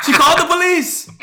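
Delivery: high, loud, laughing through it; laughing disbelief. Retold or imitated. Repeating the story's punchline to friend, laughing.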